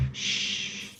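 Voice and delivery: voiceless shush. Pretend. Mock Jesus silently shushing a fan. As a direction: hush them softly. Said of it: "Just be like".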